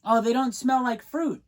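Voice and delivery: put-on a kid sniffing and deflating; little girl disappointed. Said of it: "shoves it in her face and goes".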